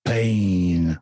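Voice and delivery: low, menacing one-word threat; Clubber Lang growl. Acted. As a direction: growl it like a boxer.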